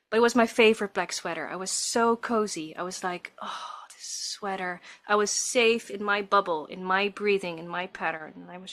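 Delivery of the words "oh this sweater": whisper. Retold or imitated. Cozy in sweater during labour.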